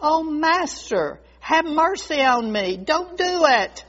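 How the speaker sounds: wounded reproach turning into a plea; anguished pleading. Acted. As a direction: beg your master for mercy. Bamboo begs not to be hollowed.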